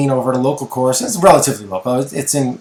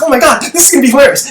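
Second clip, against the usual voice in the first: put-on high, giddy kid voice, much higher and louder; excited kid; 11 semitones higher, 4 dB louder, much faster than usual. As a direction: squeal it like a thrilled eleven-year-old. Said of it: "He's like".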